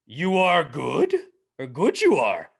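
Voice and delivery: mock Yoda word order, drawn out; a hint of Yoda. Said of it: "talk in the Yoda accent".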